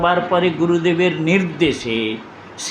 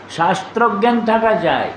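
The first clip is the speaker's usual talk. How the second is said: ordinary talk, nothing marked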